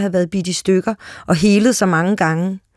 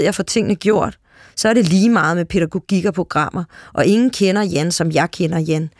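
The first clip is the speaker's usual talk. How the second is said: plain reading voice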